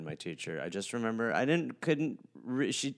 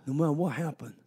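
dazed ballplayer; 2 semitones higher, 7 dB louder than usual. Acted. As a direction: hit in the face and dazed. Plays concussed player.

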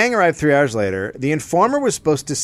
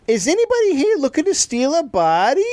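high, put-on goofy voice; goofy informer voice; 13 semitones higher than usual. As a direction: play the eager informer sky-high. Informer acts in the bar.

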